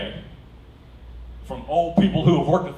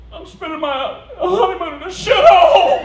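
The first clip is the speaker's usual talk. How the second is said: high, loud, slow mock wail; hammed-up tearful bride; imitating wailing wife; 12 dB louder than usual. Quoting wife's tearful complaint.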